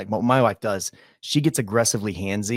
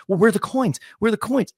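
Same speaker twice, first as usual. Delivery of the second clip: mock panicky wife; 10 semitones higher than usual. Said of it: "And then she's like".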